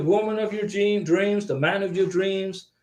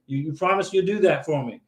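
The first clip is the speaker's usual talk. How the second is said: ordinary talk, nothing marked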